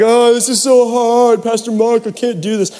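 exaggerated childish complaint; complaining kids. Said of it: "all the kids are complaining".

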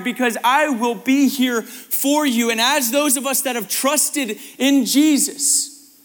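emphatic, building preacher's exhortation; impassioned preaching. Real, not acted. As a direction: drive the message home to the congregation. Preaches at rising force.

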